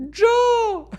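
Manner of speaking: high, slow put-on voice, laughing through it; mocking high-pitched character voice; imitating a viral video. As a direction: replay the lockdown video gag in a squeaky voice. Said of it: "ça me rappelle pendant le confinement".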